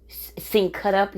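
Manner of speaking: usual speaking voice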